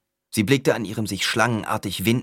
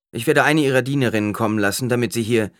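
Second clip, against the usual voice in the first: louder, fast, higher; brisk doctor; 5 semitones higher, 5 dB louder, faster than usual. A narrator's reading. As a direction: rattle off orders.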